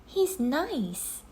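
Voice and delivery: put-on a girl, sure and fond; Annie voice. A narrator's reading.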